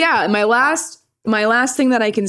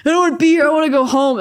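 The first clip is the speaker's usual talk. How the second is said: put-on high, louder kid-like complaint; mock childish protest, pitched up; dumb whining; 7 semitones higher, 4 dB louder, much faster than usual. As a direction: imitate yourself whining at a parent. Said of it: "I was being dumb".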